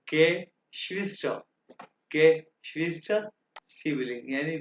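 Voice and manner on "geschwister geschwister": slow model word. Shown as a demonstration. Teacher models Geschwister for the class, very slow and deliberate. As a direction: spell out Geschwister very slowly, syllable by syllable. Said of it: "उसके बाद क्या है?".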